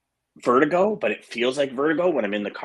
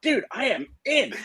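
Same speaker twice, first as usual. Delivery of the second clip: high-pitched eager declaration; mock gung-ho. Patient telling himself he can do the show, mock gung-ho. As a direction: replay your own overconfidence, pitched way up.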